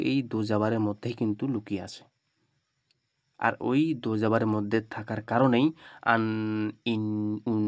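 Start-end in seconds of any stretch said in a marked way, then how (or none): 6.1-7.6 "আন, ইন, উন" with the final n hummed through the nose; nasal n sound. Shown as a demonstration. Teacher showing students the hidden nun, "আন, ইন, উন" in nasal sound. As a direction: say "আন, ইন, উন" one by one, each ending ringing in the nose. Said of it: "এই যে নাকের মধ্যে আওয়াজ আসছে".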